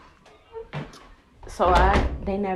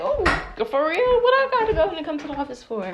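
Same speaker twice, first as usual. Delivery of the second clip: much higher, louder and fast indignant protest; outraged disbelief; 9 semitones higher, 10 dB louder than usual. Retold or imitated. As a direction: fire it back, offended. She protests being summoned.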